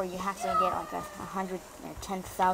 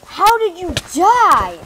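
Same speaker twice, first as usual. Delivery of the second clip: loud, slow, high jeering; hammed-up gloating shout; mocking yell; 9 semitones higher, 15 dB louder, slower than usual. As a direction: stretch it out to rub it in. Mocking a dead player.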